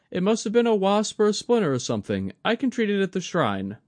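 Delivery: put-on young woman's voice, worried and kind; Mai, young woman. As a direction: play Mai fussing over an elder. Mai after sting.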